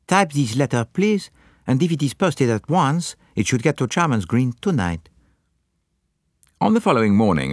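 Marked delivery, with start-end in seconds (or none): 0.1-5.1 lightly voiced Belgian detective, polite but urgent; a touch of continental urgency; Poirot voice. A narrator's reading. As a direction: press her to post it at once. Poirot instructing secretary.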